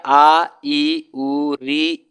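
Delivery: आ, ई, उ, री each drawn out as long vowels; long vowels recited. Shown as a demonstration. Teacher demonstrating long vowels to the class, drawn out. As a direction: hold each vowel आ ई उ री for a full two beats. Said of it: "कौन कौन होंगे भैया?".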